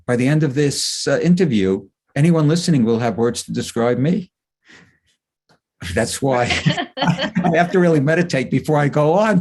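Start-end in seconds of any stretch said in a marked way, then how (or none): 5.9-9.4 higher voice breaking into laughter; laughing. Real, not acted. Interviewee joking about being described by listeners, laughing. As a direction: laugh through your own remark.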